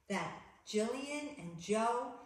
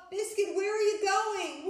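much higher, louder worried call; alarmed storytelling; 10 semitones higher, 8 dB louder than usual. A narrator's reading. Little girl calling after her puppy, higher and louder. Reading picture book to children.

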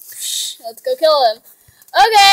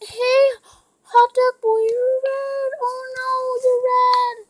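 surprised; 8 semitones higher than usual. Real, not acted. Teammate on other team.

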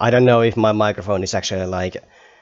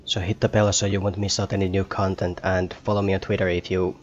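ordinary talk

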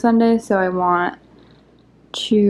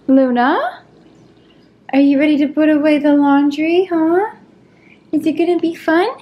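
higher, sing-song pet talk; 6 semitones higher, 3 dB louder than usual. Real, not acted. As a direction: coo at the dog playfully. Talking to her pet Luna.

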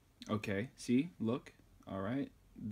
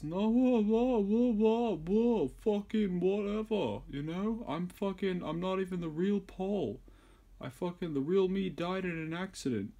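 much higher, louder dopey mock of [name]; mumbling [name]; 10 semitones higher, 6 dB louder than usual. Mocking [name] to the viewers, in a much higher dopey voice. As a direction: send up a dazed, yawning rock star in a squeaky put-on voice. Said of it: "always kinda yawning and mumbling while he's".

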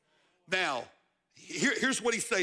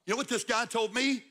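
ordinary talk, nothing marked

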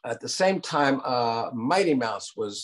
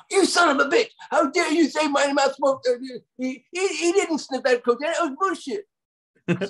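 Bakshi yelling. Retold or imitated. Ralph Bakshi yelling at the writer by phone, high mock rage. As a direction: rant at him down the phone, outraged. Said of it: "go call him and yell at him".

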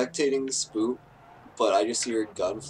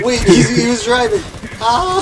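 higher, louder alarmed shout; shocked yell; 11 semitones higher, 13 dB louder than usual. Real, not acted. Reacting to a teammate dying in the car, shocked yell. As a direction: yell in surprise at the crash.

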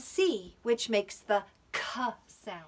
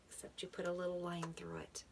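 whisper; 3 semitones lower, 13 dB quieter, faster than usual. Acted. Teacher drawing letter.

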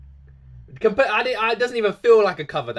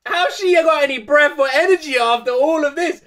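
high, louder, laughing astonishment; laughing awe; 10 semitones higher, 7 dB louder than usual. Real, not acted. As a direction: marvel through laughter. Amazed at her breath.

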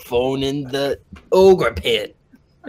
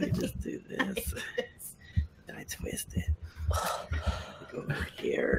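unvoiced whisper; 8 semitones higher, 16 dB quieter than usual. Real, not acted. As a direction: mutter it under your breath. Fiddling with microphone.

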